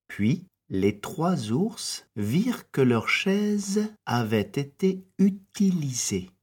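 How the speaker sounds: usual speaking voice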